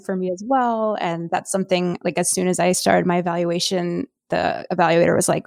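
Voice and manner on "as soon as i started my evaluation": normal talking voice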